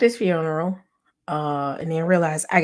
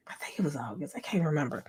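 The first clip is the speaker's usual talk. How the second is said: unvoiced mutter while working out the date; whispered to herself; 3 semitones lower, 11 dB quieter, faster than usual. Real, not acted. Unsure of the date, she whispers to herself. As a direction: trail off into a whisper, thinking aloud.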